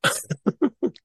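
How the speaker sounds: short high burst of laughter. Real, not acted. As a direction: laugh at being teased. Laughs at teasing.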